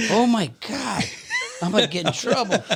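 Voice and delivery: high, excited mock panic; half-laughing oh my God; alarmed exclamation. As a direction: caught covered in glitter, mock alarm. Sparkly glitter on his shirt.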